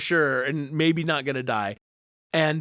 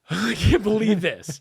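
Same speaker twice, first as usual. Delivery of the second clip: disbelieving amazement; 7 semitones higher, 4 dB louder than usual. Retold or imitated. Retold amazement at recovery.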